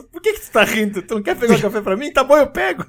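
high, put-on protesting voice; indignant coworker. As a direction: protest being laughed at, then give in. Acting coworker being laughed at.

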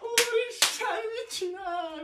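high-pitched disbelief; shocked squeal. Pretend. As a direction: squeal it in fake horror. Mock outrage at rap line.

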